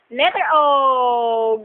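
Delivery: "Letter O" drawn out slowly; slow teaching voice. Shown as a demonstration. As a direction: spell it out slowly for a toddler. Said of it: "mother teaching letters to baby".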